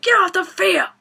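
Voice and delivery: high, loud imitated yell; mock referee shout. Retold referee ordering dad out.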